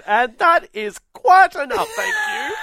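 high, loud, slow schoolteacher scolding the class; strict teacher voice. Retold or imitated. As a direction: bring a noisy table to order like a stern teacher. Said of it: "She goes".

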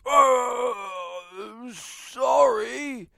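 high, half-crying sorry; sheepish apology. Retold or imitated. Stranger caught at the broken window, high sheepish sorry. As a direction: whimper an apology like a startled stranger.

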